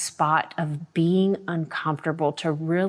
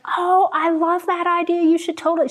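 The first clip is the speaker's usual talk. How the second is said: high-pitched, gushing friend; imitated excitement; 12 semitones higher, 4 dB louder than usual. Friend thrilled by the podcast idea, imitated high excitement. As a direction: gush with delight way up high. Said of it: "she got all excited".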